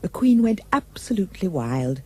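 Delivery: usual speaking voice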